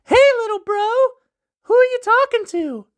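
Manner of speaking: put-on very high girlish call to her brother; sister calling out. Soraeus arriving and calling her brother, high call. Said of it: "Soraeus arrived and shouted".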